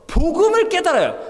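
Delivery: ordinary talk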